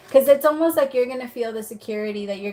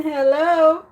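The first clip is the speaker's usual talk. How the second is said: higher, louder teasing "hello" in a put-on voice; playful hello; 6 semitones higher, 7 dB louder than usual. Real, not acted. Reader greets the reconciliation card, flirty and teasing. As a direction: tease the card like greeting a crush.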